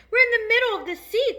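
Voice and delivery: very high, loud outcry; alarmed cry. A narrator's reading. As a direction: cry out the shocking discovery. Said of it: "cried James".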